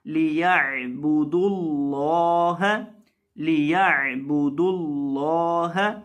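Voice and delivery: लियअबुदुल्लाह with the lam of Allah thick and the ain from the throat; heavy lam in Allah. Shown as a demonstration. Teacher recites for students, slow with heavy lam. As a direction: say लियअबुदुल्लाह slowly, thick dark l. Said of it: "लाम को मोटा करके पढ़ना है".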